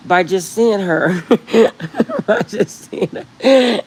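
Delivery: laughing through the words. Real, not acted. Vlogger to viewers, laughing as she repeats.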